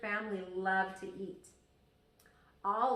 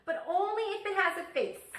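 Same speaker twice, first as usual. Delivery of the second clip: higher, louder, delighted; eager little sister; 10 semitones higher, 7 dB louder than usual. Acted. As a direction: blurt it happily like a small girl. Little sister adding condition.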